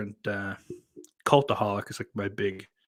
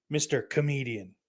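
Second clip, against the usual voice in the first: ordinary talk, nothing marked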